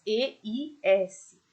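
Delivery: E I S spelled out slowly, letter by letter; slow spelling. Shown as a demonstration. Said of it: "pela terminação eis".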